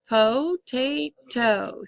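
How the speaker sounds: potato said slowly, syllable by syllable; drawn out for spelling; slow dictation. Shown as a demonstration. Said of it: "three syllables".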